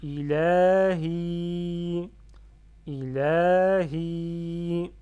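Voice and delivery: ILAHI very loud, stretched on la and hi; two long vowels. Shown as a demonstration. Teacher shows learners two prolongations, loud and drawn out. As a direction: chant ILAHI slowly, holding both long vowels. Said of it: "j'ai deux prolongements ici".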